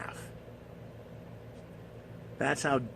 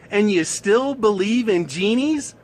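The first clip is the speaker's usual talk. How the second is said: slow, loud, raised-pitch jeer; mocking incredulity; 6 semitones higher, 6 dB louder than usual. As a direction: drag out the punchline in disbelief. Genie mocks the gullible woman.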